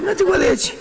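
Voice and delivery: very high-pitched mock exasperation; exasperated insistence. Stranger repeating his name in a quarrel, high exasperated insistence. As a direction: insist at the top of the voice's range.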